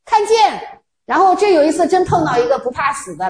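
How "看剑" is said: mock battle cry. Hunter shooting at a visitor.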